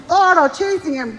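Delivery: ordinary talk, nothing marked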